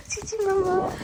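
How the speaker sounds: very high, soft pet voice; dog talk. Real, not acted. Calling Gigi to look at the snow, high soft coo.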